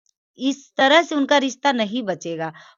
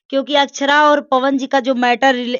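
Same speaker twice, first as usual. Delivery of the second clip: raised, louder voice pressing the point; heated insistence; 4 semitones higher, 7 dB louder than usual. Real, not acted. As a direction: argue back firmly and loudly. Heated disagreement with interviewer. (ऊँची आवाज़ में ज़ोर देकर बहस)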